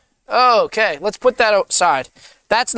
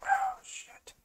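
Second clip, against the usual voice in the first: whispered curse; 17 dB quieter than usual. Real, not acted. Cursing under breath at surgery.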